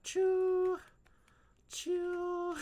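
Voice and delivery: high, loud smooching sound; kiss sound. Pretend. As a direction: blow a big cartoon kiss. Playful kiss noises.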